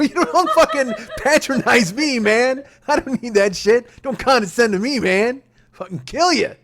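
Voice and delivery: high, loud, sputtering indignation; mock-furious retort. Husband refusing wife's condescension, mock-furious retort. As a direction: bark it with a laugh breaking through.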